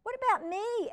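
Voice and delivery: put-on boy asking his brother, high and loud; young Elliot. A narrator's reading. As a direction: plead like a small boy. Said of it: "Elliot asked".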